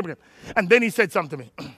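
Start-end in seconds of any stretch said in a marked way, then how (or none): 0.0-0.5 hushed whisper pulling them close. Real, not acted. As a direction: breathe it like a secret.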